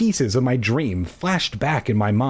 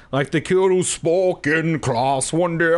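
mock kid voice; 6 semitones higher than usual. Mocking the kid's voice.

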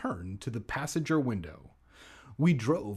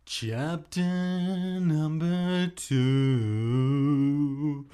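long drawn-out, half-chanted chapter title; hammed-up announcer; 3 semitones higher, 4 dB louder, much slower than usual. Reader announcing the next chapter, hammed-up drawl. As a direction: stretch every word out grandly.

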